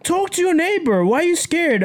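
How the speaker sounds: lightly acted high teacher prodding; imitated urging teacher.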